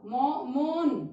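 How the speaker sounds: मो then मौन, slowly drawn, open au in मौन; slow contrast. Shown as a demonstration. Teacher drilling o versus au for children, slow. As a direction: stretch मौन's au slowly. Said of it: "तो ये अंतर है दोनों की मात्राओं का".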